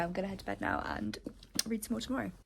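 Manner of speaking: usual speaking voice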